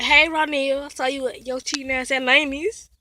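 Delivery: loud teasing call. Real, not acted. Girl teasing Ronil loudly from the car. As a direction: call him out across the lot.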